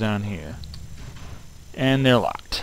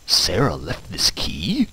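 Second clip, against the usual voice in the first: ordinary talk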